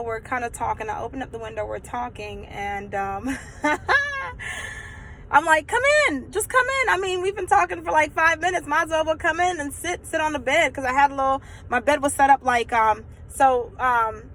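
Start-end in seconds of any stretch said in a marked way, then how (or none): 3.4-5.3 drawn-out high laugh; high giggle. Real, not acted. Storyteller remembering the window visit, high giggling. 5.7-10.7 high-pitched, eager coaxing; acted coaxing. Teen girl urging boyfriend through the window, high coaxing.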